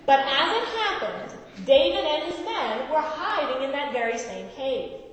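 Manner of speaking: measured Bible reading voice; scripture reading. Acted. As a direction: read the passage to the congregation. Reads scripture aloud.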